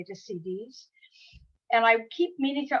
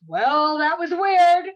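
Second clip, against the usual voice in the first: higher and much louder, hammed-up narrator reading of "weird"; dramatic audiobook narrator; 7 semitones higher, 10 dB louder, much slower than usual. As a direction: overplay the line like a dramatic narrator. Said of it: "she's so dramatic".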